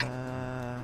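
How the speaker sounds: ordinary talk, nothing marked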